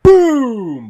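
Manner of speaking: high, loud mimicked gunshot; loud gunshot boom. The gunman firing, a loud imitated boom. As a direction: bark out the blast.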